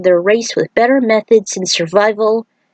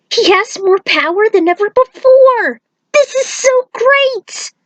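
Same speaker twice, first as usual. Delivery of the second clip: much higher, thrilled and gushing; excited cheer. Acted. Friend cheering Raiden's rise, thrilled and much higher. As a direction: squeal it out with joy, slowing on the end.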